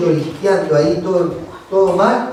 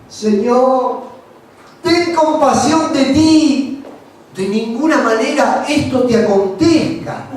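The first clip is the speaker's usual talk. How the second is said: higher, slowed pleading rebuke; Peter rebuking; 6 semitones higher, slower than usual. Retold or imitated. Peter taking Jesus aside, higher pleading protest. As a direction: beg him to spare himself. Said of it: "comenzó a reconvenirle diciendo".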